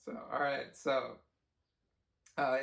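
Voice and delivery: ordinary talk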